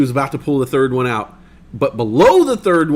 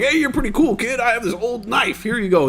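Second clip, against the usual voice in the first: put-on high friendly old-timer; old person voice; 9 semitones higher than usual. Old person giving kid a knife, high friendly. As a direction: kindly hand over the gift. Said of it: "they'd be like".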